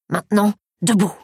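gruff order from the voice in the head; growled command. A narrator's reading. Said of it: "grogna-t-il".

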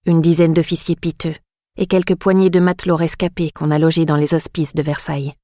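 ordinary delivery, nothing marked

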